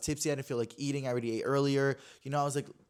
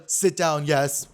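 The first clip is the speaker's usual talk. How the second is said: commanding; 9 dB louder, much slower than usual. Retold or imitated. Big man commands her to sit.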